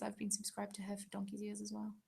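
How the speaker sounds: soft whispered aside. Real, not acted. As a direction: drop to a breathy secret whisper. Aside while reading questions.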